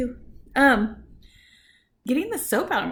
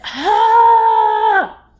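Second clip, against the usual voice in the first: loud, high, long exasperated "ah"; frustrated scream; 10 semitones higher, 12 dB louder than usual. Real, not acted. Woman venting about the rude man, frustrated scream. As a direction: let out a drawn-out yell of frustration.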